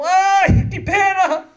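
very high, loud "ai, que pena"; hammed-up pitying sigh; mock pity. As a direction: sigh it like a sentimental bystander. Bystander sighing in pity.

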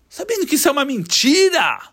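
plain reading voice

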